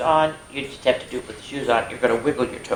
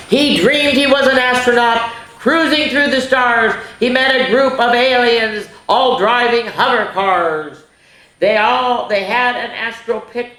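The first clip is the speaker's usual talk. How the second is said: high, lilting dreamy narration; storybook narration; 8 semitones higher, 4 dB louder than usual. A narrator's reading. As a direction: read it like a wonder-filled bedtime tale.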